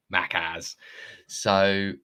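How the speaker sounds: ordinary talk, nothing marked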